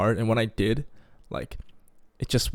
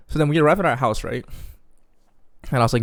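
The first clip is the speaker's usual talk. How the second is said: normal talking voice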